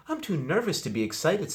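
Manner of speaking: high, tense nervous voice; nervous boy. A narrator's reading. Boy nervous before meeting the president, high and tense. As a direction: fret anxiously. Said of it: "said Arthur".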